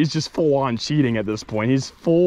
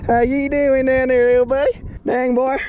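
cooing to the fish; 8 semitones higher, 3 dB louder than usual. Acted. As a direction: coo at it like a pet. Talking to the caught fish.

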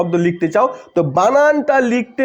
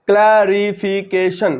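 "Clarification" slow and lower, each syllable measured; slow demonstration; 4 semitones lower, much slower than usual. Shown as a demonstration. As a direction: draw out all five syllables of "clarification". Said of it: "পাঁচবারে উচ্চারিত হচ্ছে".

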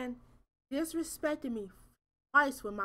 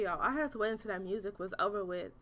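ordinary talk

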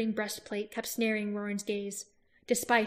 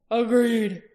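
firm, loud clipped dwarf agreement; a sharp dwarf's Agreed, said short and loud; dwarf agreeing; 9 dB louder than usual. Acted. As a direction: snap out the word in agreement. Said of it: "said Oric".

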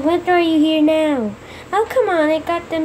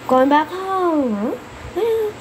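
ordinary delivery

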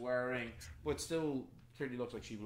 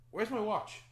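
higher, louder startled man; acted shock, pitched up; alarmed; 9 semitones higher, 5 dB louder than usual. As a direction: blurt it as if just robbed. Man finds his watch gone.

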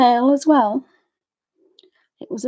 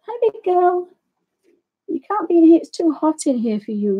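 much higher sing-song pet voice; pet talk; 7 semitones higher than usual. Real, not acted. Greeting the big rescue dog in the studio, high cooing. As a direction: coo at the dog as she runs in.

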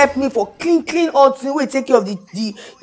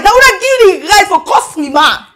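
usual speaking voice

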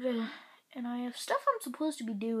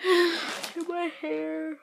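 slow, high, louder mock-whine; whiny groan; 7 semitones higher, 6 dB louder, much slower than usual. Listing all the chores before going out, mock whining. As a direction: drag it out like a put-upon whine.

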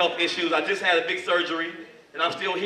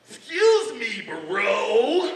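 acted aggressor's voice; 3 semitones higher, 4 dB louder, much slower than usual. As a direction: play the indignant attacker answering back. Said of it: "He says".

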